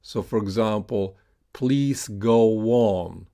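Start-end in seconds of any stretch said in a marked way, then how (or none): 1.5-3.3 slow "please go on" with go-won joined; "go on" in "please go on" as one word; linked w glide. Shown as a demonstration. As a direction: draw out "please go on", a w linking "go on". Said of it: "connected with the off glide U".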